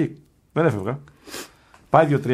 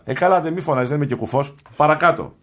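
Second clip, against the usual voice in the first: higher, indignant retold protest; mock annoyance; 6 semitones higher, 4 dB louder than usual. Monk telling the visitor to stop shouting, indignant. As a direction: object loudly, then order him onward.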